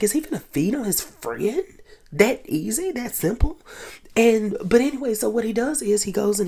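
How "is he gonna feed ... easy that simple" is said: high incredulous questions; can't believe it's that easy. Retold or imitated. Wondering if he'll feed on his friend, high and incredulous. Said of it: "but I was like".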